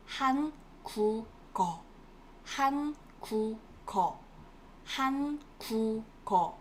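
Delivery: 한국어 syllable by syllable as 한, 구, 거; linked pronunciation. Shown as a demonstration. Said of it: "it is pronounced as".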